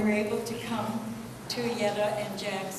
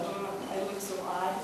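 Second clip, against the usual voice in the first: embarrassed inner thought; 8 dB quieter than usual. Retold or imitated. Inner thought, feeling out of place.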